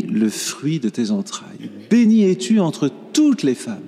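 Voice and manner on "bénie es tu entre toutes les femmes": higher, stress on toutes; emphatic repeat. Shown as a demonstration. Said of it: "repeat with stress on toutes".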